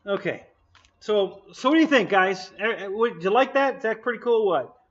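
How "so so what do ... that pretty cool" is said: excited. Real, not acted. Excited showing off to viewers.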